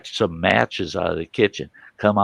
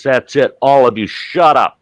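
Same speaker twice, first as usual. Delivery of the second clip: louder, raised-voice imitation of a stern father; commanding dad; 5 semitones higher, 7 dB louder than usual. Police chief dad silencing the family, commanding. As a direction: cut through the racket, punching the final order.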